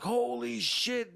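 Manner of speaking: high, slow drawn-out holy shit; retold shock. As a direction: gasp it out stretched and high. Kid sees a stabbing.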